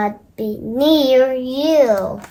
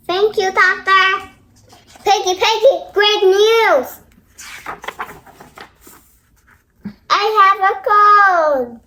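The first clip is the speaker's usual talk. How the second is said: excited news; 6 semitones higher than usual. Acted. Gerald tells Piggie great news.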